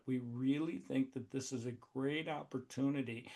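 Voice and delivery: mock a timid investor pitch with no energy; mumbling a limp, unexcited pitch; dull, lifeless pitch. Shown as a demonstration. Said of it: "talk like this and say that".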